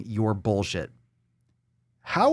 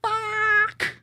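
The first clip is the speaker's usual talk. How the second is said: high despair. Retold or imitated. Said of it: "And it's like".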